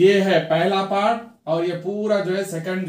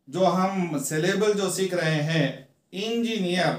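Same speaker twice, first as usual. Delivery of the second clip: normal talking voice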